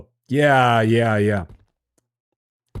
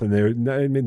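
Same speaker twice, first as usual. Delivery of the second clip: ordinary talk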